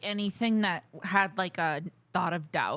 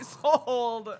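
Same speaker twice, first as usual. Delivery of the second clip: ordinary talk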